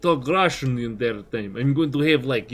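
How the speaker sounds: ordinary talk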